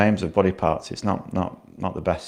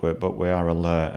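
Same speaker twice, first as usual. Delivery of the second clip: ordinary talk, nothing marked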